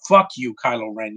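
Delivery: normal talking voice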